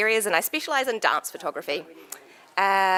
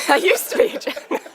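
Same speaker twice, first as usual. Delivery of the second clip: blurted out through giggles, quick and breathy; giggling while introducing yourself; laughing; 10 semitones higher, 4 dB louder, much faster than usual. Real, not acted. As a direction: laugh your way through the line. Laughs mid self-introduction.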